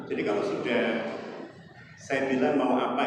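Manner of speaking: ordinary delivery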